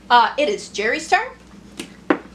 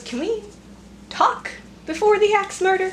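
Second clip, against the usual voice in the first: pleading NPC voice; 8 semitones higher than usual. Acted. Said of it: "He goes".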